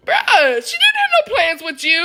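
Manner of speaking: high, incredulous call-out with a laugh; high, laughing disbelief; exasperated bruh. Acted. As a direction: scold her in disbelief. Exasperated at character.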